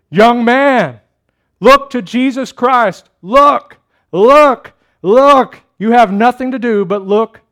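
loud, high pulpit shout; preacher's shout. Retold or imitated. Methodist preacher shouting at a young man under the gallery, loud and high. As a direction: cry it out, punching each "look". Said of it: "he shouted as only a primitive Methodist".

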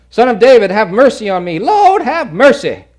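loud, high desperate cry; shouting for mercy. Acted. Preacher voicing the blind beggar shouting at Jesus, loud and high. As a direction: cry out over the crowd. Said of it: "he shouted all the more".

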